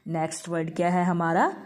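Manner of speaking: ordinary talk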